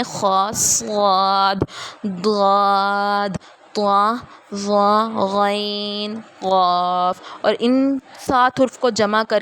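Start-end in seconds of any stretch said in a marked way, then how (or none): 0.0-7.2 خا صاد ضاد drawn out full and heavy; very slow, thick, heavy sounds; heavy letters. Shown as a demonstration. Teacher demonstrating the heavy letters slowly to class. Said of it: "وہ یہ ہیں".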